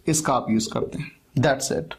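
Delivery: ordinary delivery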